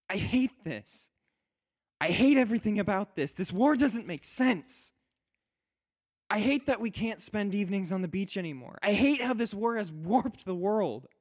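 loud, high, tearful cry of despair; tearful outburst. Acted. Wife in tears cursing the war to her husband, loud and high. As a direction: rail against the war through tears. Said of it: "she has tears running down her face".

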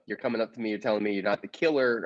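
ordinary delivery

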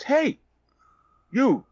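high-pitched South African call; South African accent. Retold or imitated. Stranger calling over a young actor, South African accent. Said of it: "with a South African accent".

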